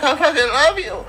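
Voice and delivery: high-pitched mock tearful cry; imitating crying. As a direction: squeal it through pretend tears. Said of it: "She was crying".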